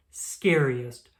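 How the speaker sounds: ordinary delivery, nothing marked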